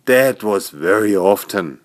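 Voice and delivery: ordinary delivery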